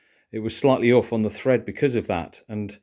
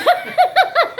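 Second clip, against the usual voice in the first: high-pitched real giggling; high giggle fit; 6 dB louder than usual. Real, not acted. Husband cracking up at his own accent, high giggles.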